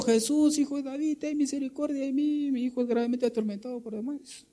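high pleading cry to Jesus, pitched well up. Acted. Preacher voicing the Syrophoenician woman crying after Jesus, high desperate plea. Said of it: "Y seguía gritando".

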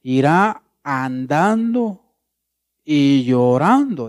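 "andando" and "llorando" stretched out slowly; slow, weighty scripture reading; slow, drawn-out reading. Shown as a demonstration. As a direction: draw the verse out as if walking and weeping. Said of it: "Mire el verso seis".

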